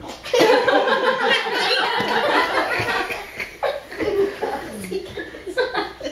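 long high laughing fit; helpless extended giggles; real laughter. Real, not acted. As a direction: fall apart laughing. Breaks up mid reading.

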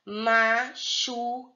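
"Machucado" slowly, split into ma, chu, ca, do with gaps; sílaba por sílaba. Shown as a demonstration. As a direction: break "Machucado" into four separate syllables. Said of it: "quatro sílabas". ("Machucado" devagar, separando ma, chu, ca, do)

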